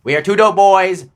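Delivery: loud, high declaiming voice; JFK impression. Co-host echoing the sign-off, loud JFK oratory. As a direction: ring it out like JFK at a podium. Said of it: "I can't do the J F K".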